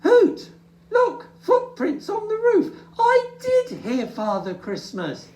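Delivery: very high, loud thrilled shout; excited cry. Acted. Little Bear spots footprints on the roof, high and thrilled. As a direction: call out with delight. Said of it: "gave a cry".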